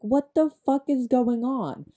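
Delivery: bewildered outburst. Retold or imitated. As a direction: act out total confusion. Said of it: "and she's like".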